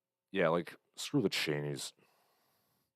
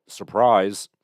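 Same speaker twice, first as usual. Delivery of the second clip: sarcastic surprise; 12 dB louder than usual. Real, not acted. Sarcastic headline reveal.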